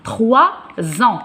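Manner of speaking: "Trois", a beat, then "ans" as "zan"; liaison demonstrated. Shown as a demonstration. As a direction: split "Trois ans" with a z opening "ans". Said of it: "je fais la liaison avec en". (liaison en Z détachée : « Trois ans »)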